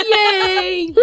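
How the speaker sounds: high laughing cheer; excited cheer. Real, not acted. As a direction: squeal with delight. Cheering new title.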